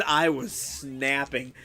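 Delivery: "snapping" stretched out slowly; drawn-out emphasis. Real, not acted. Streamer admits his fury to chat, "snapping" drawn out. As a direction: lean long on "snapping". Stresses how mad he was.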